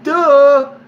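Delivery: loud, high, drawn-out mock-dumb duh. Real, not acted. Storyteller telling viewers it's obvious, loud mocking. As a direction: hammer home how obvious it is. Mocks how obvious it was.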